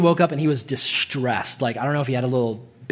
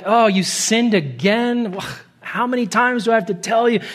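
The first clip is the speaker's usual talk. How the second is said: mock annoyance; 7 semitones higher, 3 dB louder than usual. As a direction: play a fed-up parent scolding. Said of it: "He's not annoyed".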